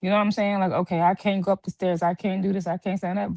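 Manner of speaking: ordinary delivery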